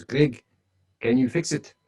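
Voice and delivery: a mimicked South African consultant putting it flatly; South African accent. South African consultant pressing Greg over the broken server, in a South African accent. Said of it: "in his really strong South African accent".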